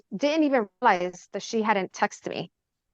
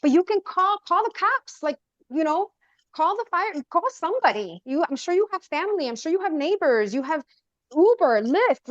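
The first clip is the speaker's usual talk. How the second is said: retold yelling; 8 semitones higher than usual. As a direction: insist in a much higher, incredulous voice. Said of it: "am yelling and I'm like".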